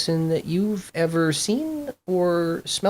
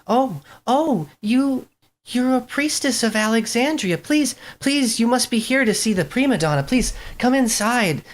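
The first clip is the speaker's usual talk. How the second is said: high, hurried, fluttering maid's voice; a nervous servant gushing with welcome; flustered nursemaid; 9 semitones higher than usual. Acted. Nursemaid welcoming the priestess at the door, high and flustered. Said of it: "and says".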